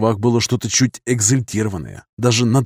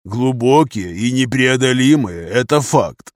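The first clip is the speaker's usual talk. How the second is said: slow echo in a colonel's voice; colonel agreeing; slower than usual. A narrator's reading. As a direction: repeat the phrase slowly, weighing it. Colonel echoes the captain's phrase.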